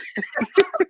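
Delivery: a real burst of high laughter. Real, not acted. Guest laughs at his own punchline about Zulu men. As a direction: laugh out loud, high and bright.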